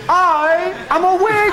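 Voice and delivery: high shrieking mock scream through laughter; screaming wig. A screaming wig on the host's head, high shriek. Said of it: "it was screaming".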